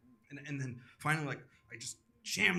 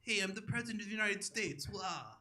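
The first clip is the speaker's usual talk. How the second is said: Trump; 5 semitones higher, 5 dB quieter than usual. Acted. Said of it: "That's my impression of Donald Trump".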